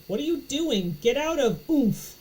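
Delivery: high loud alarmed shout. A narrator's reading. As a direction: yell at her to get out. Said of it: "I shout".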